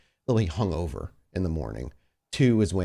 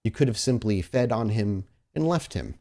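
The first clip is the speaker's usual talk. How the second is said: ordinary talk, nothing marked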